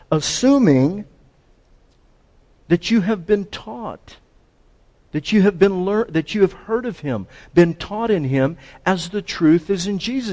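insistent, high-pitched emphasis; emphatic. Acted. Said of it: "He uses an emphatic".

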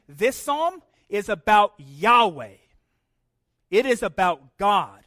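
声を張り「It is about God」を力強く; slow, loud proclamation; emphatic preaching. Acted. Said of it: "he is saying".

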